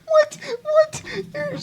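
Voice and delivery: very high, squeaky "what? what?"; can't believe what she just heard; stunned disbelief. Real, not acted. Disbelief at the contradiction.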